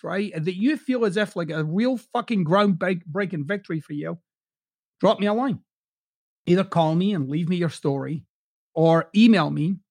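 ordinary talk, nothing marked

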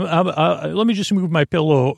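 ordinary talk, nothing marked

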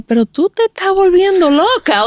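high-pitched imitation of an exasperated husband; mock exasperation. Husband to his panicking wife who wants formula, mock exasperated. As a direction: act out his incredulous scolding.

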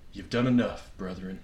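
low, soft, dying away at the end; hushed refusal. Retold or imitated. Imitating the grieving dog owner refusing their help, hushed refusal.

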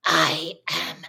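low, menacing whispery granny; evil granny. Retold or imitated. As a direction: play granny turned evil at sundown, word by word. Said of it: "evil Granny at six thirty-five P M".

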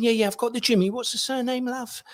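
high English woman's voice, patient; English copy taker. Retold or imitated. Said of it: "she's saying".

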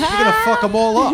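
laughing. Real, not acted. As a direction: tease her through laughter. Teasing while laughing.

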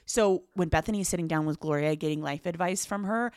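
usual speaking voice

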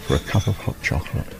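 soft, breathy near-whisper of an invitation; softly, gently. Retold or imitated. Girlfriend inviting Mike in for hot chocolate, softly whispered. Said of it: "She spoke very softly, very gently".

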